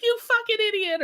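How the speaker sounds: hammed-up screaming at the cabbie; mock shriek. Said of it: "screaming at the taxi driver".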